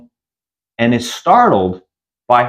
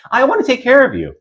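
much higher, fast and cooing; too friendly; 12 semitones higher, faster than usual. Retold or imitated. Friend mothering Carly, high and cooing. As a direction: coo it quickly.

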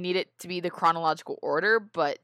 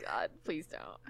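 hushed, whispered aside; whispered plea; 11 dB quieter, slower than usual. Real, not acted. Friend pleads after a joke about hate mail, whispered. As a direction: whisper it under your breath.

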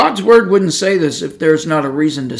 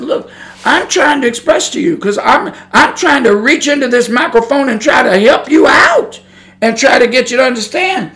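intense plea; 6 semitones higher than usual. Shown as a demonstration. Preacher shows how he pleads with intensity, raised and urgent. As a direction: push it through the microphone with urgency. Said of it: "intensity in my soul".

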